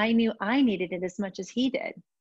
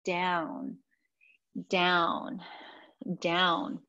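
slow soothing count; much slower than usual. Shown as a demonstration. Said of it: "not up and down vigorously".

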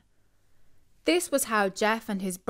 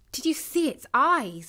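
breathless excitement; 6 semitones higher than usual. A narrator's reading. Boy tells the family about glowing eyes, high and thrilled. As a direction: gush about the demon bull. Excited kid recounting scare.

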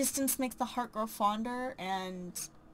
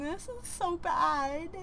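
whiny mock despair; 6 semitones higher, slower than usual. Real, not acted. Regretting sim's choices.

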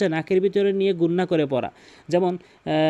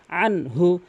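very slow chanted recitation of আনহু মালুহু; Quran recitation; much slower than usual. Shown as a demonstration. Teacher reciting the verse for students, slow chant. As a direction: draw out the verse as in tilawat. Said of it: "এভাবে দ্রুত বলতে হবে".

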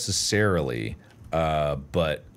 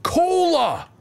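excited shout; 4 dB louder than usual. Real, not acted. Excited discovery.

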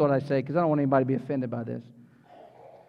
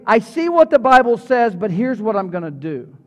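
dismissive believer; 4 semitones higher, 7 dB louder than usual. Retold or imitated. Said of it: "and they say".

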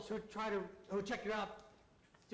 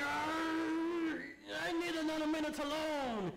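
long growled-out yarr, then raised call; pirate bellow; 4 semitones higher, slower than usual. A narrator's reading. Pirate captain calls from the toilet, bellowing. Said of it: "Captain Crag bellowed from the John".

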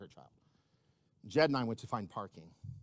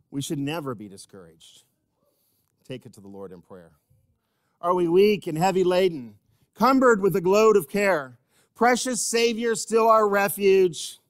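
hymn recited, unsung; 5 semitones higher, 9 dB louder than usual. Real, not acted. Preacher leading the church through a hymn, dramatic spoken recitation.

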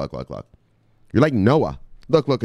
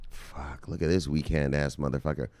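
much softer and lower, under the breath; inner thought; 8 semitones lower, 10 dB quieter than usual. Retold or imitated. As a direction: mutter it like a private thought. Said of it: "what Leonidas was thinking in his head?".

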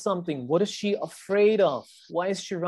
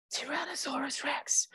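hushed, voiceless whisper; 10 semitones higher, 8 dB quieter than usual. A narrator's reading. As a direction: whisper it as Jack, much softer. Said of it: "whispered Jack".

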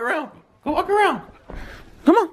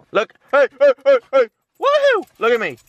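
much higher, louder calls; excited pet call; 11 semitones higher, 4 dB louder than usual. Real, not acted. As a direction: get the dog to look up at you. Getting dog's attention.

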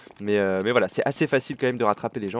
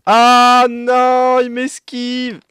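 dismayed shout; 10 semitones higher, 11 dB louder, much slower than usual. Real, not acted. The target dodges him.

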